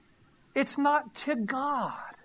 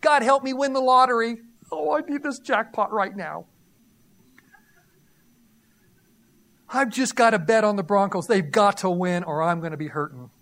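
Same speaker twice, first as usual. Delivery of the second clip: loud, pleading mock prayer for a jackpot; desperate gambler's prayer; 6 dB louder than usual. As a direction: beg God like a gambler about to lose it all. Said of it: "Even non-believers pray".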